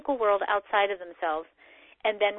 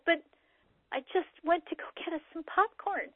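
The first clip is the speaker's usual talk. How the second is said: higher, softer, meek innocent protest; bewildered partner; 6 semitones higher, 7 dB quieter than usual. Retold or imitated. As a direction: plead innocence, lightly voiced and confused. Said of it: "your poor manifestor partner".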